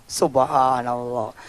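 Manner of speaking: slow, hushed, low Subhanallah; awed praise. Real, not acted. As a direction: breathe out wonder, drawing it out. Awed exclamation again.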